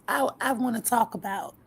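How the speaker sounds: normal talking voice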